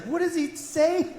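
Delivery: put-on high little-girl voice; curious young daughter. Daughter asking what the seal says, high child voice. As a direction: imitate a child asking dad. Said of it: "My daughter's like".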